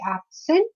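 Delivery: "absent" with stress on the first syllable; slowly stressed "absent", noun form. Shown as a demonstration. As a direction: lean on the AB in "absent". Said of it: "the stress is on the first syllable".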